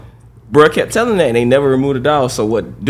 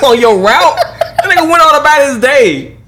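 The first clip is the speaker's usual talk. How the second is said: laughing; 9 semitones higher, 4 dB louder than usual. Real, not acted. Still laughing.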